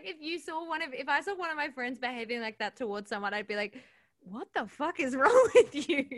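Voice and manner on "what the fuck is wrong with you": mock outrage. Retelling her reaction to friend.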